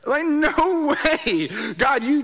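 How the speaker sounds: slow, loud, high disbelief; incredulous. Retold or imitated. Refusing God's call, slow loud disbelief. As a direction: draw it out, no way.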